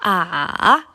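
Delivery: dipping "A", down then up; long falling-rising "A"; third tone. Shown as a demonstration. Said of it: "turun dulu, kemudian naik lagi".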